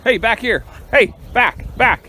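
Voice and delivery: loud high calls to the herding dog; shouting dog commands. Real, not acted. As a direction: yell at the dog to get it to come back.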